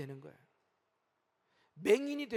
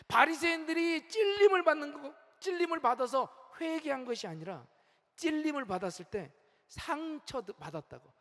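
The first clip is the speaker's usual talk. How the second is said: normal talking voice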